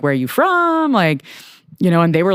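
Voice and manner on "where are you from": high, chatty and light; friendly director voice. Retold or imitated. Director chatting with the PA, lifted high and friendly. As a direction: ask it like a nice guy making small talk. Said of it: "was like".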